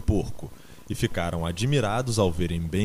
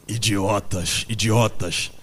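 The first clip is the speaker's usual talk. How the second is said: mild exasperated outburst; angry exclamation; slower than usual. A narrator's reading. Benjamin berates the animals, mildly angry exclamation. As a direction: scold them as fools. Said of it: "exclamou Benjamin".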